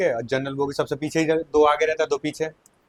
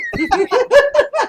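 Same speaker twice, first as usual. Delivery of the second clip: a loud high burst of laughter; loud amused laugh; 6 dB louder than usual. Real, not acted. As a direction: crack up at the deal. Laughing at vendor's price drop.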